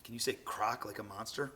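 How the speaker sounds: ordinary delivery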